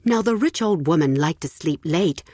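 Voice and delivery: usual speaking voice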